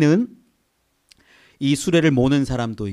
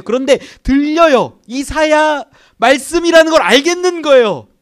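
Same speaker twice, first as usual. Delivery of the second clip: high, loud rising exclamation; excited preaching; 10 semitones higher, 9 dB louder than usual. Real, not acted. Preacher telling how Philip hears the words, excited and loud. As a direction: build it up with excitement.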